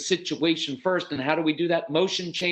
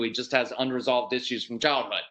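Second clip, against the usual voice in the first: ordinary talk, nothing marked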